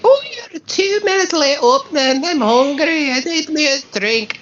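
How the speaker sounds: high whiny complaining voice; whiny drunk customer. Retold or imitated. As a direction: whine like a rowdy regular. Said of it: "people get rowdy".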